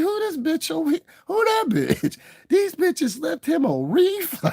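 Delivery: very high mimicry of the aunts; aunts' voices.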